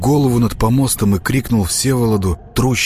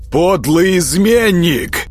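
high, drawn-out accusing cry; angry shout; 10 semitones higher, 3 dB louder, slower than usual. A narrator's reading. Prince shouting after a fleeing traitor, outraged. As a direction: shout it slowly after him. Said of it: "закричал ему вслед Всеволод".

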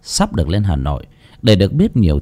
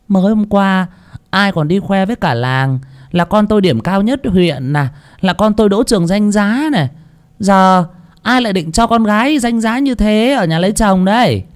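higher, cheeky back-talk daughter; sassy retort; 9 semitones higher than usual. Acted. Daughter Hồng sassing her mother back, cheeky. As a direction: answer back pertly and smug. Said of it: "cong cái mỏ lên mà xoen xoét cãi".

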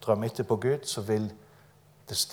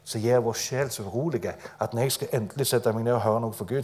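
normal talking voice

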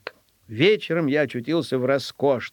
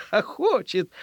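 ordinary delivery, nothing marked

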